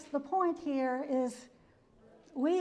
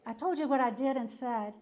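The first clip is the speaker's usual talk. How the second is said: normal talking voice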